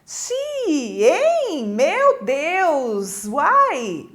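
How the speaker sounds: drawn-out, swooping exclamations of astonishment; hammed-up surprise; demonstrated surprise. Shown as a demonstration. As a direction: act amazed, stretching each word up and down. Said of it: "Espanto ou surpresa".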